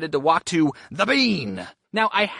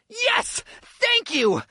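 high, excited mock scream; screaming fan; 13 semitones higher, much slower than usual. Audience member finally called on, mock scream. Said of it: "The guy stands up screaming".

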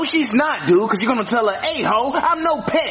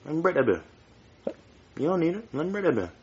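hushed urging; 2 semitones lower, 7 dB quieter, faster than usual. Acted. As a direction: lean in and coax quietly. Conspiratorial urging to break bracelet.

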